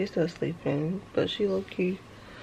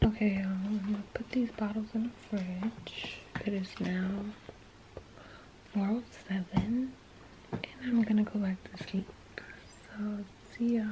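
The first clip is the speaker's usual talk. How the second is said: whispering; 9 dB quieter than usual. Real, not acted. Early morning, baby asleep.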